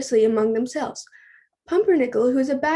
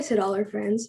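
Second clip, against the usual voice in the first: usual speaking voice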